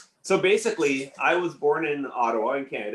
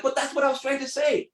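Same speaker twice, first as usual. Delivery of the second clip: very high-pitched protesting squeal; mock exasperated; 13 semitones higher than usual. Misunderstood student after classmate gets credit, mock exasperated. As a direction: whine it way up high in comic protest.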